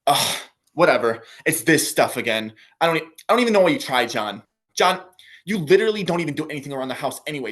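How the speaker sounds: fast, loud, exasperated nagging; annoyed girlfriend. Retold or imitated. Girlfriend brushing off John's ninety-day run, fast and exasperated. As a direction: scoff and rattle it off, fed up with John. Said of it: "better than hearing this".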